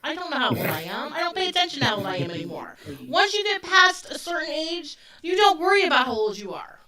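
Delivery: playful loud protest about forgetting your age; joking exasperation. Real, not acted. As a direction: toss it off like a joke.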